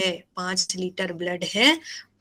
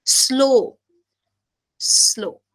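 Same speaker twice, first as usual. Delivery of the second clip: Slow with a long vowel; lingering, demonstrative slow; slow, stretched word; 3 semitones higher, much slower than usual. Shown as a demonstration. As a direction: draw out slow to show slowness. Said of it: "बहुत ही धीरे होता है".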